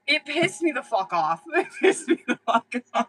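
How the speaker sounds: laughing through the complaint; real laughter while venting; laughing rant. Real, not acted. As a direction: let the laugh break through. Laughing while ranting about husband.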